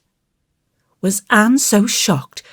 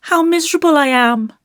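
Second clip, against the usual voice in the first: put-on high, quick, woeful little-boy voice; plaintive child, pitched high; miserable child; 11 semitones higher, faster than usual. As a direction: lament it like a small boy. Davy voiced confessing his misery.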